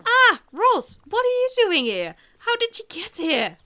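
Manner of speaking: high, loud startled yelp; startled Mike. Acted. Voicing startled Mike as Ross appears. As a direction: jump out of your skin at the sight.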